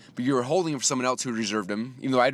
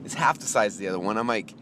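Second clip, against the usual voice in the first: ordinary delivery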